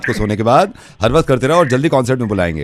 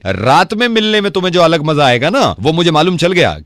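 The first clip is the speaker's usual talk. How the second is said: higher-pitched, indignant telling-off; angry scolding; 5 semitones higher, 5 dB louder than usual. Real, not acted. Radio host confronts a husband about other women, scolding. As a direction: take him to task, pitch raised.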